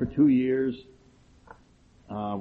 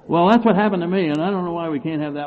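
louder, higher indignant protest; indignant retort; 5 semitones higher, 5 dB louder than usual. Retold or imitated. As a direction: insist hotly, a bit raised. Retold argument in AA meeting.

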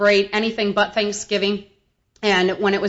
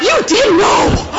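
high, loud, slow exclamation; exaggerated retold outburst; mock outraged shout; 13 semitones higher, 8 dB louder, much slower than usual. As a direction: shout it in disbelief at mom. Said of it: "And I'm like".

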